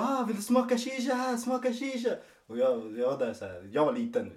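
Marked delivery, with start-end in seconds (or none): 0.0-2.1 much higher imitated mom voice; mother offering. Mom offering her homemade liquor to the twelve-year-old brother, high coaxing voice. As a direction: play his mom, coaxing the little brother to taste.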